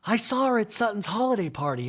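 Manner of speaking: lightly voiced Kyle; Kyle impression. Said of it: "Kyle, in this scene goes".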